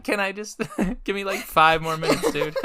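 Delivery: laughing. Real, not acted. Cracking up at the bit.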